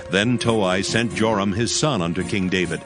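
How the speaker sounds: ordinary talk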